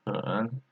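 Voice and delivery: "En" dipping in third tone; soft "En" with a low dip. Shown as a demonstration. Teacher showing learners "En" in third tone. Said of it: "third tone".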